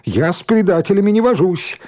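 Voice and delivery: high, emphatic disdain; haughty rejection. Acted.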